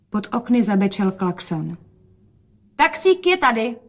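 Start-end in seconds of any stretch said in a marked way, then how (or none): 2.7-3.8 higher, louder excited call. A narrator's reading. Rozetka calls out that the taxi has arrived, higher and louder. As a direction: call out that the cab has come. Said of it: "zvolála Rozetka".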